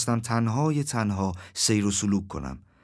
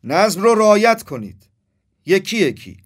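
loud, raised-pitch crowd-control shout; 6 semitones higher, 5 dB louder than usual. A narrator's reading. Crow guard shouting orders to the wounded crows, shouting. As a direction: call out orders over a crowd. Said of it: "داد زد".

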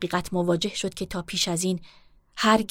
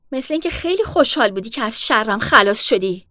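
hurt accusation; 3 semitones higher, 8 dB louder than usual. Acted. Sister accusing during quarrel.

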